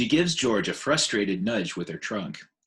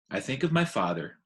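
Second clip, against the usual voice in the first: usual speaking voice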